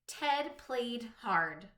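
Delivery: "Ted played hard" said very slowly, the final D of each word stressed; slow, final sounds stressed. Shown as a demonstration. Teacher to young pupils, very slow with each final D stressed. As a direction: land hard on the D at the end of every word. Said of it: "tell me the last sound of each word".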